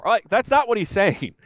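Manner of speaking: usual speaking voice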